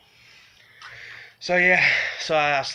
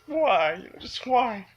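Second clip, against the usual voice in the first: imitating a tearful, high-pitched plea; crying plea; 9 semitones higher, slower than usual. Michael crying into the man who hit him, pleading. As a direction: retell his anguished question, choked up. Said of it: "he was crying into me".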